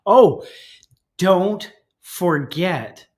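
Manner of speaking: ordinary talk, nothing marked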